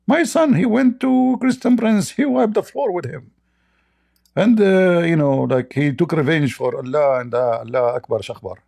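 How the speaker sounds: high mocking mom's voice; mock proud mother. Mocking the opponent's mother bragging about her son, high proud voice. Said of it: "She will be proud".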